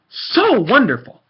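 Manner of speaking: slow, dripping sarcasm; sarcastic. Real, not acted. Ranting about people, calling them sarcastically wonderful. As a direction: say it as if it meant the opposite. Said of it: "quote-unquote".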